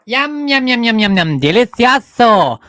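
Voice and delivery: loud, high yum yum; hammed-up yummy. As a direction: relish it like a cartoon. Relishing food loudly.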